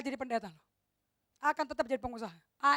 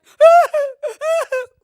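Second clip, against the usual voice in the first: laugh; 10 semitones higher, 18 dB louder than usual. Real, not acted. Laughs telling father's death.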